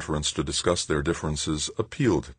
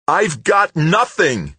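roaring, high and much louder, slow; roared; 7 dB louder, much slower than usual. A narrator's reading. Tavalera roaring at Stoner, furious. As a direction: roar it out in fury. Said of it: "he roared".

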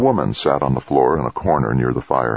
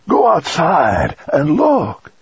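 high, loud sudden cry; urgent cry; 13 semitones higher, 4 dB louder, slower than usual. A narrator's reading. Grandfather crying out to his grandson, high and loud.

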